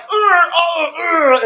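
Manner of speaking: high, loud imitated grunts of a villain taking hits; mock pained grunts. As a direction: ham up Thanos groaning under the blows. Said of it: "Thanos was like".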